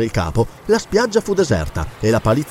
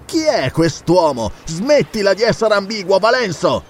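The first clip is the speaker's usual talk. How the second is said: impatient demand; 6 semitones higher than usual. Acted. Zarono demands an answer.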